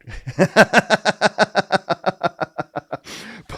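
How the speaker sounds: high bright burst of laughter; real laugh. Real, not acted. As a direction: laugh at the joke. Laughs at own bit.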